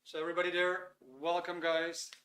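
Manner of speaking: normal talking voice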